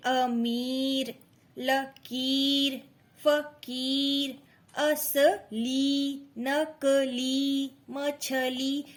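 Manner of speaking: each word stretched very slowly; हर शब्द बहुत धीरे, खींचकर; slow word drill. Shown as a demonstration. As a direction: read the list out for a child to repeat. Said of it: "तीन अक्षर वाले ऐसे शब्द".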